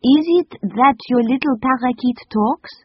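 put-on high French-accented question; French lady asking. A narrator's reading. The lady asks the children about the bird, French accent. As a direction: wonder aloud in a French accent.

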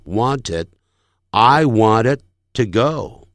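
"wanted" with d unreleased, brief pause before "to go"; stopped final d. Shown as a demonstration. Teacher modelling natural English, "wanted" stopped on a silent d. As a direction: hold the d of "wanted" silently, then "to go". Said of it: "他停顿了".

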